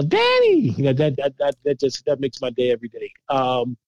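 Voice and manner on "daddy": put-on high-pitched child calling for Dad; imitated kid's yell. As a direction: call out like an excited kid coming home. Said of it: "they come in and yell".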